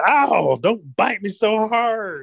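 high, slow pained rabbit cry; Rabbit yelping in pain. Acted. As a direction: yelp it out like a bitten rabbit. Rabbit bitten, yelps in story.

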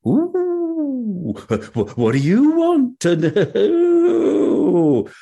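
slow, high, drawn-out coo; seductive devil's wife. Acted. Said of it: "by the devil's wife".

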